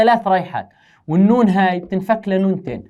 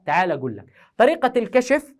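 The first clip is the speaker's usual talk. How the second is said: usual speaking voice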